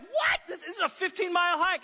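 very high-pitched, hammed-up incredulous protest; shocked disbelief. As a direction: squeak out a mock-outraged objection. Retold shocked protest to trip leader.

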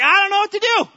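high, loud and panicked; overwhelmed believer crying out; frantic. Acted. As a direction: throw up your hands in despair. Acted frantic self in story.

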